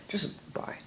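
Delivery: soft unvoiced bye; embarrassed hushed sign-off to nobody; whispered goodbye. Real, not acted.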